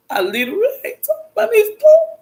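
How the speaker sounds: real crying, voice rising high; breaking into tears. Real, not acted. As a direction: start steady, then break into a high sob. Breaks down crying mid-story.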